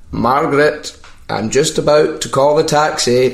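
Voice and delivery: a hint of an oblivious old fella; old guy. Old-man prank caller talking aside to Margaret, lightly aged voice. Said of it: "pretend to be, like, an old guy".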